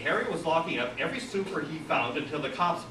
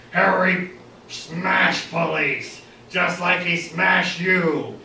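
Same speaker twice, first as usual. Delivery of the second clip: slow, loud, raised caveman bellow; brutish threat; 5 semitones higher, 6 dB louder, much slower than usual. A narrator's reading. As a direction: threaten slowly and loudly like a hulking brute. Brute Harry threatens Cal.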